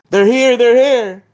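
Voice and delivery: high, loud, drawn-out alert; mock alarm, calling out slowly and high; acted lookout's cry; urgent warning. Ryuk warning that they arrive.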